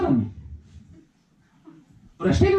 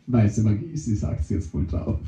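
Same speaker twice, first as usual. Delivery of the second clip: low dopey mushroom voice; 3 dB quieter than usual. Acted.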